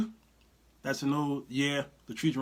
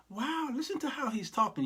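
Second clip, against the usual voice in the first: "Wow, listen" gushed higher and softer; mock amazed admirer; 8 semitones higher, 5 dB quieter than usual. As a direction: play the condescending fan, all breathless wonder.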